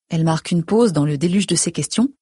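ordinary delivery

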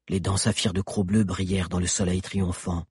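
ordinary delivery, nothing marked

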